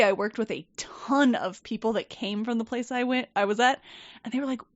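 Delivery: normal talking voice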